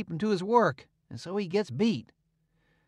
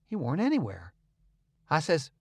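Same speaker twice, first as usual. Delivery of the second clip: ordinary delivery, nothing marked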